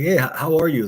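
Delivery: hint of a local Pittsburgh accent on a friendly hello; Pittsburgh accent greeting. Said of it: "I'd be like".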